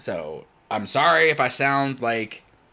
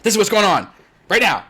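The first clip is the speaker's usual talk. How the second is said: curt, louder no-nonsense statement; blunt; 8 semitones higher, 9 dB louder, faster than usual. Shown as a demonstration. Busy streamer answering someone, curt and blunt. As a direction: state it straight to the point, no pleasantries. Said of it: "like, blunt".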